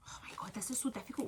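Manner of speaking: whispered under her breath; frustrated whisper. Real, not acted. Vlogger struggling to wrap a gift, frustrated whisper. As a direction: hush it out in exasperation. Muttering to herself while wrapping.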